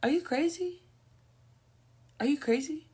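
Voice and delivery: high, loud incredulous cry. Real, not acted. Calling the grandfather crazy, high loud disbelief. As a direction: ask it like he's lost his mind.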